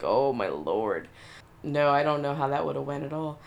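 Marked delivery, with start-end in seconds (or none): 0.0-1.1 scared. Retold or imitated. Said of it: "I would've been like".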